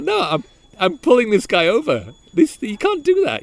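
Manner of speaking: high, loud mock outrage at the other driver; angry driver ordering a car to pull over; retold fury. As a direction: replay the anger at a reckless driver, pitched high. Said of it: "really angry".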